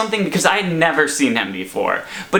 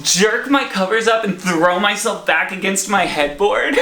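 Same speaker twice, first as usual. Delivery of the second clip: plain reading voice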